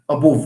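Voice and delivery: above said with a full O, mocking the mistake; mocking wrong pronunciation. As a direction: imitate a student saying above with a rounded O. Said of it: "we don't speak like that".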